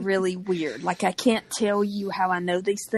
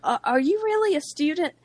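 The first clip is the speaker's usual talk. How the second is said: put-on high, louder snooty girl's quiz; mock perky registrar; 9 semitones higher, 4 dB louder than usual. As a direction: ask it like a suspicious perky student. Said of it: "She was like".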